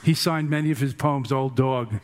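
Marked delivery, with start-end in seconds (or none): none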